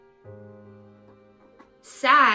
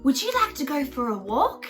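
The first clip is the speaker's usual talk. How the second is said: put-on gentle boy voice; faster than usual. Boy coaxing scared dog.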